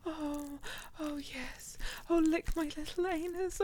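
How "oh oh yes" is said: softer, all-breath whispered gasps; breathless whisper. Acted. Catgirl in the window, whispering in awe to her partner. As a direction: whisper it as if overcome.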